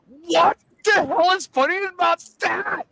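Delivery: high-pitched, hammed-up disbelief; incredulous retort. Retelling his question to the laughing friend, incredulous. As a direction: imitate your own squealed, incredulous outburst.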